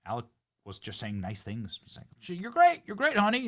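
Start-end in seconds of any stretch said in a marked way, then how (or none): 2.4-3.4 very high, loud mock praise; squeaky, hammed-up flattery; mock gushing husband. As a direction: imitate a husband overdoing praise for his wife. Mimicking husband's gushing praise of wife.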